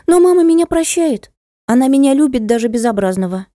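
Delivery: put-on high childlike voice, pleading conviction; little boy, earnest. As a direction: pitch it high like a small child defending himself. (высоким детским голосом, убеждённо)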